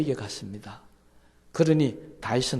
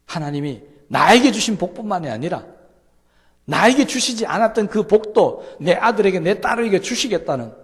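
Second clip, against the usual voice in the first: loud, high, ringing climax of the sermon; fervent preaching; 7 semitones higher, 10 dB louder than usual. Real, not acted. Pastor crying out God's promise to the congregation, loud and high. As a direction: proclaim it at full voice to the whole room.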